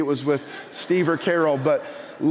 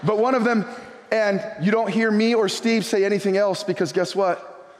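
ordinary talk

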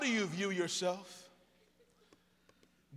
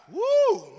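high, loud "woo"; excited whoop; 12 dB louder than usual. Real, not acted. Reacts to congregation about dogs.

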